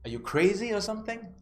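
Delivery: high-pitched mock disbelief; incredulous. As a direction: ask it as if the request is absurd. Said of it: "the look on his face".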